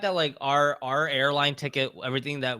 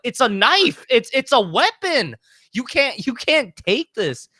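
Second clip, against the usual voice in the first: high, loud, slow scolding warning; stern security guard; 11 semitones higher, 6 dB louder, slower than usual. Retold or imitated. Security to traveler, loud stern warning. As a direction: lay down the rule like an alarmed guard.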